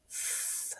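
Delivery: a soft, voiceless f hiss; letter sound. Shown as a demonstration. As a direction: breathe out a long, soft "F" with no voice. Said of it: "let's go through and do our sounds".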